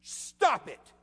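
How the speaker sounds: loud, high, sharp command; scolding unruly children. Acted. Jesus to wind and sea as unruly children, scolding. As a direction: order a misbehaving child to quit. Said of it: "as if they were unruly children".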